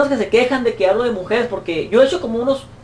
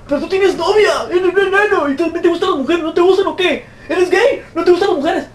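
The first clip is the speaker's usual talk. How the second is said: higher taunting mock voice; jeering higher-pitched imitation; mocking critics; 6 semitones higher than usual. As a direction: parody hecklers' accusations. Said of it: "porque me han dicho".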